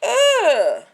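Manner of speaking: loud high ugh of disgust; disgusted groan. Real, not acted. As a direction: react to the gross sight. Disgust at the wound.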